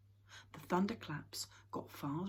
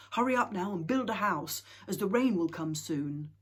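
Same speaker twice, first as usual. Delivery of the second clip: urging; 3 semitones higher, 9 dB louder than usual. Acted. Father urging the family.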